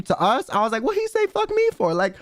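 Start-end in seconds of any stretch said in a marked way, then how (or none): 0.8-1.9 very high, incredulous mock protest; indignant. Storyteller thinking the passenger cursed her, high mock indignation. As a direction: pitch it way up, acting out affronted disbelief. Taking the scream personally, acted indignation.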